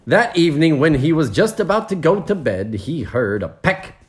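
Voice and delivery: lively, lilting storybook reading; story narration. A narrator's reading. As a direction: lead the listeners into the next scene. Reads the story aloud.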